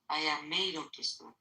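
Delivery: normal talking voice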